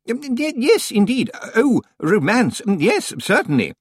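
high, hesitant, distracted old librarian; Senior Librarian Swinsley. A narrator's reading. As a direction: mumble it while rummaging, fluttery and high. Swinsley flustered.

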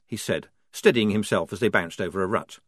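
usual speaking voice

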